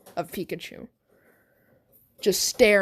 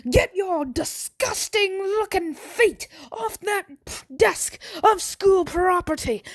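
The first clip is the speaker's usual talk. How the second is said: angry Irishman; 11 semitones higher than usual. Acted. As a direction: rage in an over-the-top put-on Irish accent. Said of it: "I start to speak like an Irishman".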